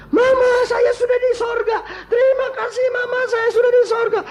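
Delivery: very high piercing child impression; imitated child's voice. Dead child thanks mother from heaven through medium, high-pitched cry. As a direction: mimic a child calling out thanks. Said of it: "Dia buka mata, dia buka suara".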